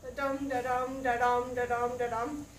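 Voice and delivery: "dum" stressed and "da" light, a steady da-DUM beat; da-dum rhythm. Shown as a demonstration. Teacher chants the meter to students, da-DUM pattern. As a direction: tap out the iambic beat as syllables. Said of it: "Did you hear that bump?".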